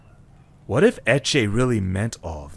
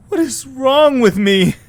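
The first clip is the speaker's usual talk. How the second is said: anguished cry; 13 semitones higher, 8 dB louder than usual. Acted. Eche alone, despairing.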